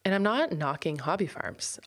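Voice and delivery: ordinary talk, nothing marked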